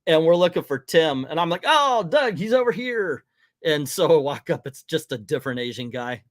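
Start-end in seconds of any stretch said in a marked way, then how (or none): 1.7-3.2 high-pitched mock call to a friend. Pointing out Tim to Doug, calling out eagerly. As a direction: call over as if you've spotted someone.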